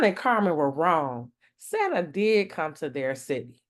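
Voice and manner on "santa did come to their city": plain reading voice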